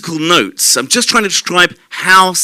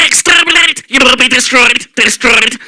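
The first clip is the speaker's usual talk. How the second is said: high, harsh robotic screech of a Dalek; 7 semitones higher than usual. Acted. Beatboxer shows the audience a Dalek, robotic and shrill. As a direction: bark it out like a killer machine. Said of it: "so it can sound like a Dalek".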